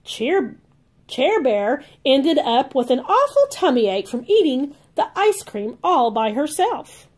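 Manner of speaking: usual speaking voice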